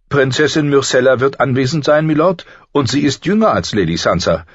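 the septa persuades the lord on Sansa's behalf, prim. A narrator's reading. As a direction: make the case politely but firmly. Septa Mordane speaks up.

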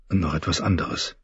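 plain reading voice

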